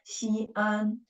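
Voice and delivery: "Xi'an" with "xi" and "an" kept apart; two beats on "Xi'an"; two syllables. Shown as a demonstration. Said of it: "two syllables".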